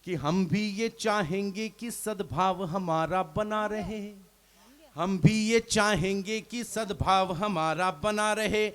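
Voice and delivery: measured recited couplet, each line dwelt on; poem recitation. Acted. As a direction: recite it like a stage poet. Poet reciting verse on stage.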